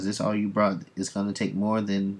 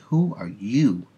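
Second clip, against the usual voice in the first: slow, louder indignant challenge; getting upset; 5 dB louder, slower than usual. Retold or imitated. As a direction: confront him, drawing each word out. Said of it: "now she's getting upset".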